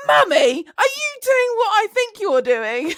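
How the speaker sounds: put-on high, loud, slow little-boy question; child's voice. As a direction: play the shocked child loudly. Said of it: "he goes".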